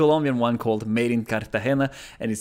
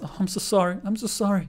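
higher-pitched imitation of gushing apologies; over-apologize in a higher voice; mock apologizing; 7 semitones higher, faster than usual. Learner imitating the couple who bumped, mock apologetic. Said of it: "Again, they apologize".

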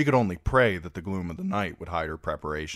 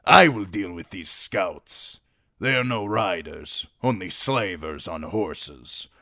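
proud Dothraki rider; 5 dB louder than usual. A narrator's reading. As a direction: dismiss the slavers like a warrior. Voices Jhogo, a Dothraki rider.

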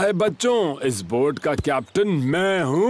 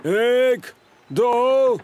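excited higher count to three; race countdown; 4 semitones higher, much slower than usual. Acted. (जोश से गिनती)

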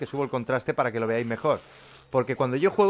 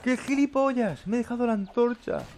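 self-scolding; 11 semitones higher than usual. Real, not acted. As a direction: drag out the self-insult. Scolds himself after forgetting torch. (lamento agudo y lento contra sí mismo)

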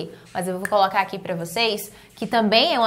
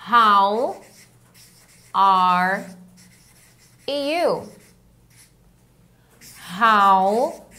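one word at a time, long pauses; much slower than usual. Shown as a demonstration. Teacher drilling how are you feeling, word by word. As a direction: draw out each word of the phrase separately. Teacher breaks phrase into single words.

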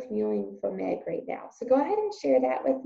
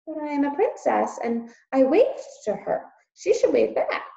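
princess voice; 6 semitones higher, 4 dB louder than usual. Acted. Princess insists.

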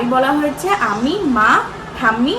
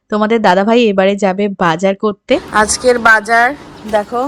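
normal talking voice

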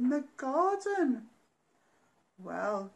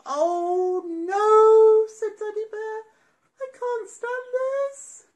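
higher louder wail, slow; exasperated daddy bear; 10 semitones higher, 13 dB louder, slower than usual. Acted. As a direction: whine in despair.

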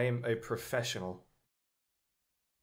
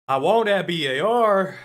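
louder, higher desperate want; excited pleading; 6 semitones higher, 9 dB louder than usual. Real, not acted. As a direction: beg the game for it. Pleading for drop.

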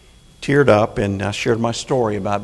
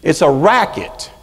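angry; 5 semitones higher, 5 dB louder than usual. Real, not acted. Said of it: "I am angry".

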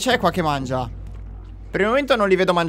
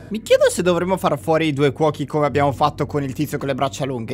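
ordinary talk, nothing marked

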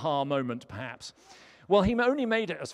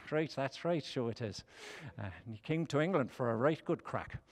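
a light Irish lilt, quiet and low; Irish accent; 3 semitones lower, 4 dB quieter than usual. Retold or imitated. Preacher keeps up a light Irish accent telling Mark's story. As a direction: keep a touch of the Irish accent going. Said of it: "tempts me to do an Irish accent".

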